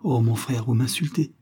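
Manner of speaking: ordinary delivery, nothing marked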